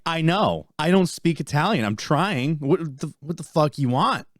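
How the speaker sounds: loud, high-pitched retold outburst; exasperated protest. Retelling his reply to laughing baristas, exasperated. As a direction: protest in hammed-up frustration.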